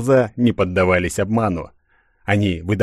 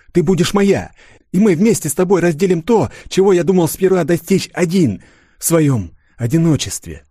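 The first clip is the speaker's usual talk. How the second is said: passionate insistence; 9 semitones higher than usual. Acted. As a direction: urge her with rising passion. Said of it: "страстного шёпота".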